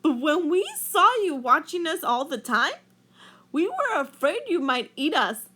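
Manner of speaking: mild Rainbow Fish explaining. A narrator's reading. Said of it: "he said".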